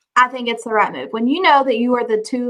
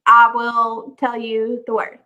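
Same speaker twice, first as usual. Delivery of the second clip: slow salesgirl voice; slower than usual. Retold or imitated. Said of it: "You know, like".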